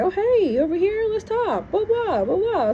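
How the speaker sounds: high, singsong "come over here" call; breezy mock greeting. Younger self calling brother's friends over, high mock-friendly greeting. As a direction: imitate a chatty, high-pitched hello.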